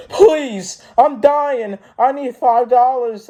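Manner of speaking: high-pitched wailing plea, hammed up; mock desperate beggar. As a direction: plead like a dying man, very high. Retold desperate beggar pleading on bus.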